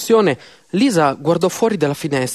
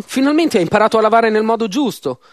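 higher, quicker narrated astonishment; mild surprise; 6 semitones higher, 3 dB louder than usual. A narrator's reading. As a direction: call a spouse over in pleasant surprise. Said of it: "Lisa fu sorpresa". (lieve sorpresa, tono più alto)

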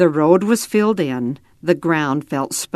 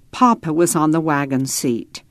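plain reading voice

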